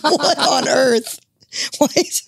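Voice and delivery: high, drawn-out disbelief; slow, stretched "what on earth"; incredulous. Retold or imitated. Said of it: "I'm like".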